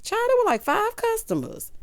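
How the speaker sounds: high and incredulous, slowing at the end; amused disbelief. Real, not acted. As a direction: squeal it, on the edge of laughing. Amused exclamation at tiny crowd.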